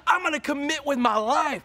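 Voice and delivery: loud, high preaching build. Acted. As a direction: preach it out with full force. Preaching at full force.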